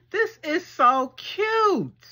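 overexcited squeal she then reins in; a little too excited, high and drawn out. Real, not acted. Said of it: "Let me tone it down 'cause I know I'd be getting too excited".

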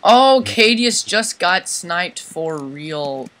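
loud, slow, excited exclaim. Real, not acted. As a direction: shout it out slowly, amazed. Excited reaction to a snipe.

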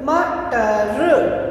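मटर stretched out very slowly; slow teacher blend of मटर. Shown as a demonstration. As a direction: draw out मटर sound by sound. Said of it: "बिल्कुल धीरे से".

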